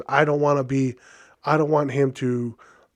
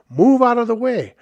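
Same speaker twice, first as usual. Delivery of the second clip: raised, high-pitched bark at a crowd; exasperated shout; 8 semitones higher, 4 dB louder than usual. Retold or imitated. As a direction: call out impatiently to clear the path. Acting out yelling at crowd.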